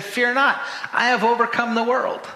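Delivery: high, forceful proclamation; ringing, triumphant quote; bold declaration. Acted. Acting out Jesus' bold declaration.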